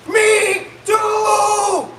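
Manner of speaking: high, drawn-out yell; enthusiastic shout. Pretend. Comic agreeing with the audience, enthusiastic shout. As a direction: holler agreement with mock zeal.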